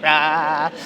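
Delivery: ordinary talk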